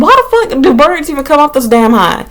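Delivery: high exasperated rant; a touch of mock outrage at the bird. Annoyed at the pigeon on her balcony, a high exasperated rant. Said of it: "And I'm like".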